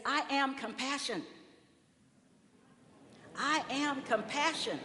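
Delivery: declaration to neighbor. Shown as a demonstration. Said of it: "turn to your neighbor and say".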